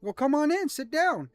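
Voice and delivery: put-on bright welcoming young lad; cheerful young Makoto. Young boy welcoming the party into the cart, high and eager. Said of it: "he looks at you and he says".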